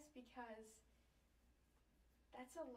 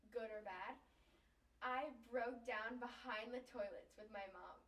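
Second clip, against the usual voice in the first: ordinary delivery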